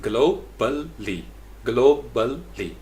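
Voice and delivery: globally very slowly as GLO... bul... lee; deliberate split of globally with pauses; three syllables. Shown as a demonstration. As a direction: sound out globally beat by beat. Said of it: "इसी तरीके से globally".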